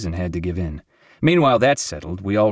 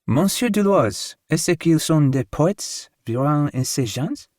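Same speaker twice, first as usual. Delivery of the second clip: high, slow, prim French landlady; high, slow French lady; landlady speaking French; 7 semitones higher, slower than usual. A narrator's reading. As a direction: lift the voice up into a proper Parisian lady. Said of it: "She said to me in French".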